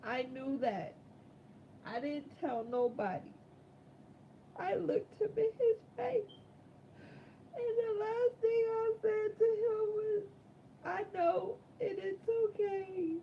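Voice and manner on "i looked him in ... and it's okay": tearful, holding back sobs; crying. Real, not acted. Telling the audience about his last goodbye, crying. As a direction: voice breaking high with tears.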